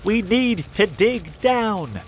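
slow insistent shout. A narrator's reading. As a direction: stress need, push them on. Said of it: "shouted Mister Fox".

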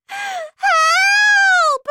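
very high, long, loud scream for help; terrified child's drawn-out scream. A narrator's reading. Trapped boy cries for rescue, piercing scream. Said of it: "I began to scream".

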